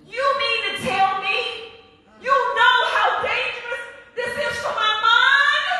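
high, loud, impassioned sermon voice; full-force preaching. Acted. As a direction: preach it to the rafters.

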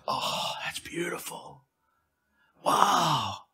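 hushed, slow whisper of wonder; God marvelling softly at a baby in the womb; awed whisper. Pretend. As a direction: breathe it out in awe.

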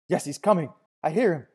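loud, high joining cry; excited shout. A narrator's reading. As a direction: shout it in shared frenzy. More worshippers join the frenzied shouting.

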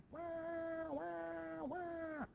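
high mock baby wail; hammed-up wah wah wah wailing. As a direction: wail like a child in a fit, hammed-up. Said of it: "Stop that wailing".

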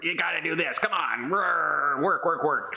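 lightly voiced pushy fitness coach, hammed up; mock hardcore trainer. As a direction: tease the drill-sergeant workout style. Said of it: "nothing, like, hardcore".

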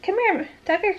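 high, coaxing call to the dog; sing-song call to a pet; calling the puppy. Real, not acted. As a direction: coax the puppy over.

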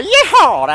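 a high, happy cowboy yeehaw; country whoop. Acted. Fan finishing his country song, whooping yeehaw. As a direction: let out a cheerful whoop to end the song.

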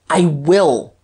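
loud, determined retort; emphatic outburst; crying out. A narrator's reading. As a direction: cry it back with force. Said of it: "cried Guerchard".

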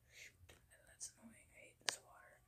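soft whispered grumble; annoyed whisper. Real, not acted. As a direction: whisper your frustration. Annoyed at water in game.

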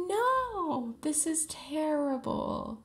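high, drawn-out cry of protest; over-the-top high-pitched dismay; dismayed wail. Real, not acted. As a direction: wail it, stretching every word. Favourite player is sent home.

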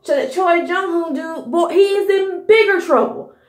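normal talking voice

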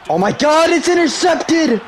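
high-pitched, amazed outburst; thrilled shout. Real, not acted. Gamer seeing a pass intercepted, thrilled shouting. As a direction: yell in disbelief at a takeaway.

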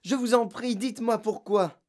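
high, urgent plea; distressed child imploring; shocked pleading. A narrator's reading. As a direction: beg the teacher for an answer. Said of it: "encore essoufflée et sous le choc".

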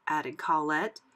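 ordinary delivery, nothing marked

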